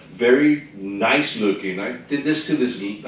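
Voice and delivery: ordinary talk, nothing marked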